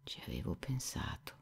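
very soft, whispered confession; low voice. A narrator's reading. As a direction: confess it under your breath. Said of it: "rispose Alyosha a bassa voce". (confessione a bassa voce, sussurrata)

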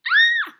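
loud, very high shriek; startled scream. Real, not acted. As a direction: shriek as the monster appears. Startled during scary game.

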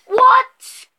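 sudden high squeak; surprised what. Real, not acted. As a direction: react startled and loud. Startled by brother's jab.

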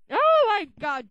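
loud exasperated cry. Real, not acted. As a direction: groan loudly at the noise. Reacting to horrible singing.

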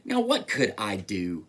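low, lightly voiced mouse; low storybook scoff; dismissive mouse. A narrator's reading. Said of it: "The mouse laughed".

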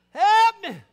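high, very loud cries for help; desperate full-voiced rescue call; drowning man's holler. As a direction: shout as if going under. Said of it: "are you drowning?".

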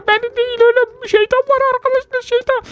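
high, panicked squeals of fear; panicky high cries. Acted. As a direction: squeal as it catches you. (yüksek sesli panik çığlıkları)

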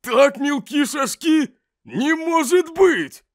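drawn-out, high-pitched cry of disbelief; high, stretched-out astonishment; shocked exclamation. Acted. Shocked exclamation in acted scene.

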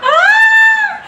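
a real high, loud shriek of shock; shocked scream. Real, not acted. Vlogger shrieks at the couple's fight, high and loud. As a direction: shriek in sudden disbelief.

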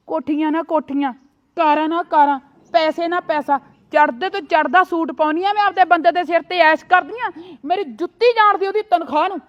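loud, building angry boast; furious loud bragging; angry rant. Acted. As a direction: shout down the sister-in-law with pride. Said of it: "ਤੂੰ ਤਾਂ ਊਂ ਹੀ ਗੁੱਸਾ ਕਰੀ ਜਾਨੀ ਆ".

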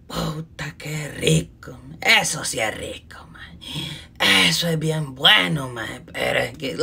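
low, slow, relishing murmur; low savoring whisper. Acted. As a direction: savor every word, hushed. Recuerda el alcohol con gusto. (susurro grave y goloso)

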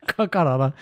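stumbling Ka-, Karala with a final a; teasing the wrong word Karala; mispronounced Karala. Retold or imitated. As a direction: mimic the slip of saying Karala. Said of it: "the first one you said".

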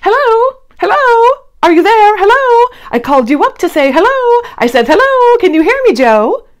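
high, loud hello into a dead line; calling on the phone. Acted. Character shouting into the phone to Joe, loud high calling. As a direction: call out as if nobody can hear.

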